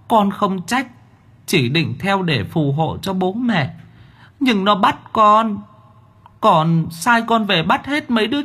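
put-on very high, meek pleading child-demon; timid demon child. A narrator's reading. Demon child confesses to the master, meek and pleading. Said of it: "ngồi dậy khép nép".

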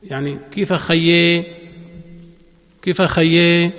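خيي with the final vowel bent to e, Beirut style; Levantine imala. Shown as a demonstration. Teacher showing students Lebanese imala. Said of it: "إمالة".

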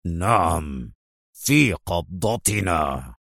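low, slow, drawn-out menace; vehement insistence. A narrator's reading. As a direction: grind out في قبضتنا slowly and low. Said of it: "في ثورة مستطردا".

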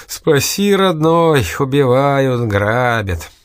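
high-pitched mock plea, falling away; hammed-up wail for rescue; mock cry for help. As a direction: pretend to be a victim calling out.

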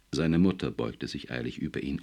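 ordinary delivery

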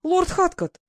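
sharp high exclamation of the name; startled outburst at the news; exclaiming. Acted. Duchess hearing her niece met Lord Hatcot, exclaiming. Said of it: "воскликнула герцогиня".

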